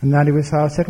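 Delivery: ordinary delivery, nothing marked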